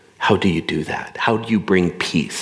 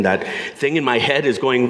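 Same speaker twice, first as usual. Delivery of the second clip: ordinary delivery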